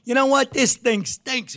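high mock outraged squawk; imitating Pat's outburst. [name] retells Pat Cooper exploding at a reshoot, mock screaming. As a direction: ham up an old comic blowing his top. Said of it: "He starts screaming".

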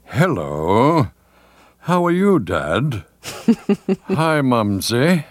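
hammed-up, swooping announcer greeting; self-mocking, overblown broadcaster voice. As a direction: greet the family as if on air. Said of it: "deepen your voice and give it an authority".

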